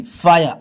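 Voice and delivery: फायर as "FAA-ya", the r dropped; correct "fire". Shown as a demonstration. Teacher correcting the wrong readings, "faa-ya" for फायर. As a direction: say फायर with an "eye" vowel, falling at the end. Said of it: "आई बोलेंगे".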